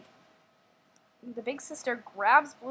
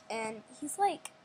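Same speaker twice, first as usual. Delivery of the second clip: ordinary delivery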